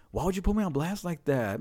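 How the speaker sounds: high-pitched imitated grievance; mock complaint. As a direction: whine like a called-out friend. Voicing Kenny's complaint.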